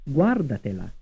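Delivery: "Guardatela" with the beat on GUAR; first-syllable stress. Shown as a demonstration. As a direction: hit the opening "Guar" of "Guardatela", let the rest fall. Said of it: "stressed on the first syllable".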